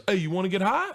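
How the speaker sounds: lightly voiced high-pitched impression; a hint of an excitable comedian; Tracy Morgan. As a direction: pitch it up like a sly invitation. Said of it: "so then he goes".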